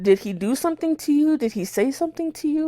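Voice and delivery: high, rapid-fire questions; retold urgent questioning. Questioning her cousin about what the guy did, urgent.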